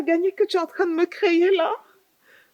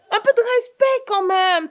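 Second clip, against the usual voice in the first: scolding; 6 semitones higher, 8 dB louder than usual. Acted. Aunt scolding niece on phone.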